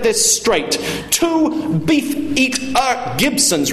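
high, hectoring, pompous customer; bullying condescension. Acted. Father dictates his order to old waiter, bullying condescension. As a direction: spell it out as if to a dimwit.

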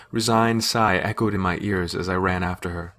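ordinary delivery, nothing marked

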